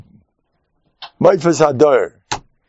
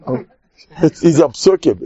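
ordinary talk

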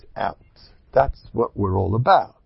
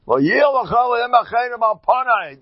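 much higher, emphatic recitation; declaimed verse; 11 semitones higher, 4 dB louder than usual. Real, not acted. Teacher citing the ban on other gods, declaimed high. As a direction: proclaim the commandment high and forcefully.

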